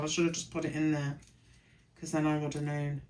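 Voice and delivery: ordinary delivery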